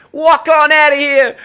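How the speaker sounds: high, loud, slow New York yell; jeering New York accent; mock New York shout. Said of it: "we're like".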